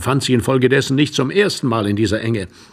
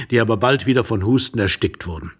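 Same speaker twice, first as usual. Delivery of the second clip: usual speaking voice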